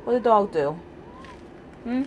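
ordinary delivery

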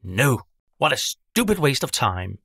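a cranky witch scoffing; complaining witch. Acted. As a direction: sneer at the festival like a sour witch. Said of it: "she complains".

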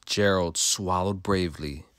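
ordinary delivery

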